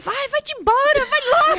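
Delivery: high, loud mock shout; grito irritado encenado. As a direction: shoo a partner away in a retold row. Retold furious quarrel with partner. (gritando agudo, mandando embora)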